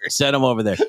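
low mumbled instruction; imitated old man. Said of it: "He's like".

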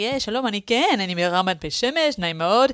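put-on Israeli accent. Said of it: "me doing my Israeli accent".

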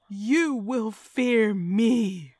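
menacing possessed screech; screeching spirit. A narrator's reading. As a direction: screech it out, drawn long. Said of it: "I screeched and tittered".

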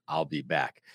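put-on low, soft German accent; Arnold impression. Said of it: "the German accent".

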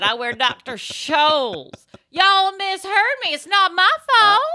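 slow, loud, high mock innocence; Southern playing dumb. Mocking the suspect's excuses, loud and high. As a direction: drawl out a fake excuse. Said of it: "the Southern playing dumb".

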